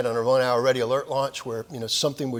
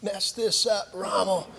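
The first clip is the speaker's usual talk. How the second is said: weary drill sergeant; 8 semitones higher, slower than usual. Retold or imitated. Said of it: "and he said".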